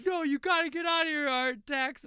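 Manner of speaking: high, loud frantic plea; panicked Atreyu. Acted. Atreyu begging his sinking horse, high and frantic. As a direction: beg the horse to get out.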